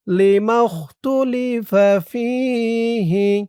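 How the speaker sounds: drawn-out dua phrase; slow melodic Arabic recitation. Shown as a demonstration. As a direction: chant it slowly and evenly.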